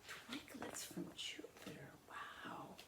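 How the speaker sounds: hushed, wondering whisper; storyteller whispering in wonder; awed whisper. A narrator's reading. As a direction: breathe it in awe. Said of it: "narrator wonder".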